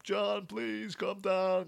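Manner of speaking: high, drawn-out pleading, softly imitated; slow, high mock begging; mock emotional plea. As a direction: act out an overemotional plea. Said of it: "really get emotional about it".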